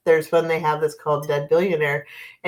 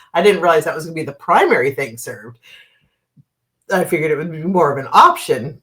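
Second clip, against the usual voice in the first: ordinary talk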